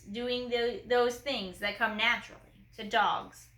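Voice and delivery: plain reading voice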